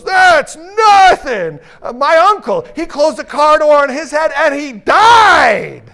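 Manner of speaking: very high, slow, loud shout; one-upping shout. Retold or imitated. Third man topping the others' stories, with a very high, slow shout. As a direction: blast out the winning tragedy. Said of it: "another guy comes up and goes".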